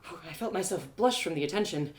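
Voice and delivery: ordinary delivery, nothing marked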